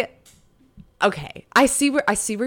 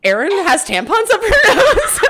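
laughing; 9 semitones higher, 7 dB louder, slower than usual. Real, not acted. Joking podcast title, laughing.

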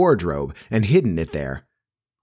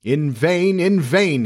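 higher, dramatic outcry; crying out; 7 semitones higher, much slower than usual. A narrator's reading. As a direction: declaim it, rising on the repeat. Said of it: "he cried".

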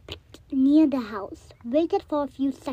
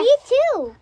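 excited; 10 semitones higher, 7 dB louder than usual. Real, not acted. Hyped reply.

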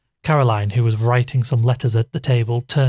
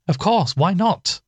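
higher, slow and stern; stern cry; 8 semitones higher, slower than usual. Acted. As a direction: cut her off with stern impatience. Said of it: "cried Caroline sternly".

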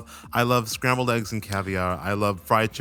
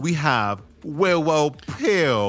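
higher, very slow, stretched-out introduction; runway announcement; 4 semitones higher, 3 dB louder, much slower than usual. Pretend. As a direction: draw the name right out as you announce it. Announces next runway queen.

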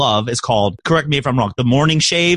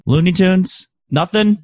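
ordinary talk, nothing marked

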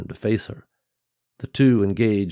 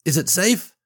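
put-on higher, louder child's question; eager little boy; 10 semitones higher, 4 dB louder than usual. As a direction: ask like a hungry kid. Boy runs to mother eagerly.

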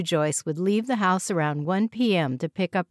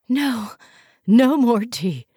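sick refusal; 5 semitones higher, 6 dB louder, slower than usual. A narrator's reading. Joyce refusing tea, sick.

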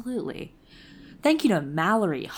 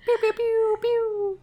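high pew sound effects. Pretend. Sound effects after patron thanks.

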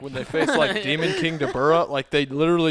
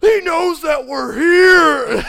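high, loud, drawn-out cry; mock alarmed wail; 10 semitones higher, 7 dB louder, much slower than usual. Retelling a villain's alarm for laughs, mock wail. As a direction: stretch it slowly into a hammed-up shout. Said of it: "And he's like".

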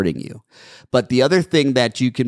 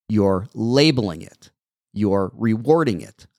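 ordinary talk, nothing marked

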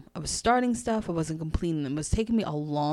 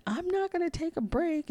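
very high mock-crying whine; hammed-up crying voice, pitched way up; imitated tearful protest; 14 semitones higher than usual. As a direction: whimper it stubbornly, as a retold tearful refusal. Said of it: "I'm crying while I'm saying this".

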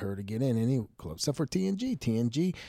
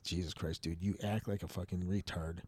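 muttered aside; 3 semitones lower, 8 dB quieter than usual. Retold or imitated. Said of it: "I'm laughing because I'm like".

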